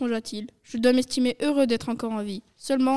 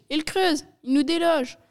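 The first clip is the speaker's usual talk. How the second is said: raised, urgent cry; alarmed yell; 4 semitones higher, 5 dB louder than usual. A narrator's reading. Maître Renard warning his family of the digging, yelling. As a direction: call out the danger loudly. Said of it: "hurla-t-il".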